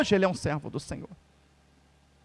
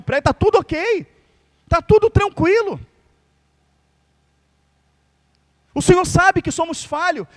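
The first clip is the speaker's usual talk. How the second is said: fiery preaching; 8 semitones higher than usual. Acted. Preacher warning the church that sin has no weight today, fiery preaching. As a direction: preach it hard and keep building. Preacher building to full force.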